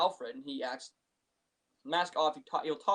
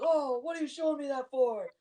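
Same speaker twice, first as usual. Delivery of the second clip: high, put-on protesting voice; hammed-up high-pitched protest; mock screaming; 8 semitones higher, 3 dB louder than usual. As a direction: imitate a rattled suspect crying out. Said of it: "they're screaming at him".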